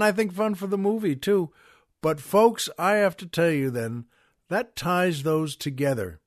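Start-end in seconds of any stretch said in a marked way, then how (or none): none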